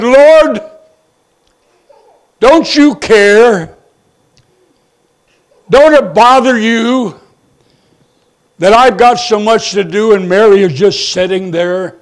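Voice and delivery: Martha fed up, letting the Lord have it; aggravated complaint. Retold or imitated. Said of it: "finally she gets so aggravated, upset".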